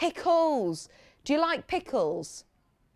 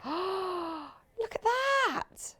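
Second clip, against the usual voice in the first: a gasp, then slow, hushed wonder; awed gasp; 8 semitones higher, 5 dB quieter, much slower than usual. Real, not acted. Presenter marvelling at a panel to viewers, hushed and slow. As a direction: breathe it out in awe at the colours.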